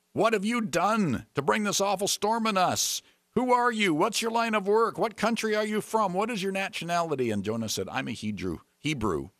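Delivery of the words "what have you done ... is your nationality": high-pitched, insistent string of questions; urgent interrogation. Acted. Sailors demanding answers from Jonah in the storm, urgent and high.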